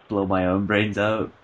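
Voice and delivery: normal talking voice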